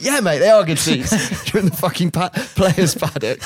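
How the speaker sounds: acted-out incredulous comeback; exasperated retort. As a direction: answer an idiot in disbelief, half laughing. Exasperated player answers fan, acted.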